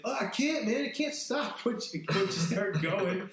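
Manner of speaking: high, goofy voice for the guy who can't stop peeing; whiny excuse. Retold or imitated. Voicing peeing guy, sheepish excuse.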